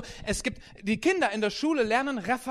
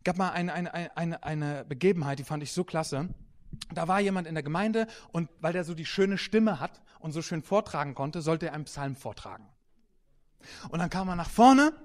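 ordinary talk, nothing marked

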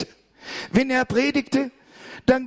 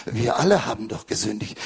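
retold minister; 14 semitones lower, 4 dB quieter than usual. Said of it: "Sagt er".

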